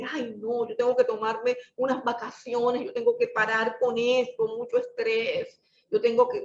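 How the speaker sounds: whiny mock complaint, voice jumping up on stress; mock whiny believer. Preacher mimicking a tired believer who wants to quit, whiny. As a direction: play a worn-out believer moaning. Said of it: "de que".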